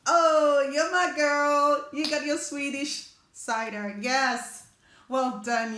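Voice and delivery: loud, slow, thrilled cheer; happily cheering a viewer on; delighted praise. Real, not acted. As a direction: cheer her on like a proud friend.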